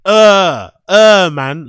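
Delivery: loud scornful uh man; loud dismissive. Retold or imitated. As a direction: brush him off loudly. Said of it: "loudly, right?".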